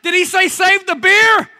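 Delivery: high, loud baffled shout with a laugh; hammed-up sailors mishearing; mock confused crew yelling. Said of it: "I bet they said".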